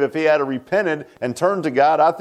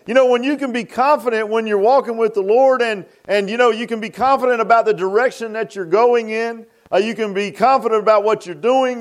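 raised preaching voice building then easing; 7 semitones higher than usual. Acted. Pastor preaching to his congregation, fervent and raised. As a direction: press the point home to the flock.

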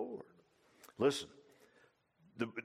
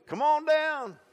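calling out; 11 dB louder, slower than usual. Retold or imitated.

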